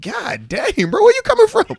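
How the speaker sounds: hammed-up startled yelp at the sound; reacting to the friend's wrecked voice with mock shock; mock surprise. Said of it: "He's like".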